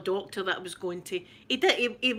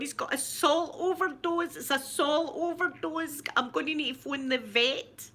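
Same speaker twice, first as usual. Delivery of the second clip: higher, slow, imitating her own tearful panic; retold tearful panic; 7 semitones higher than usual. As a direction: replay the sobbing worry about the dog, mildly. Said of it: "I was crying, going".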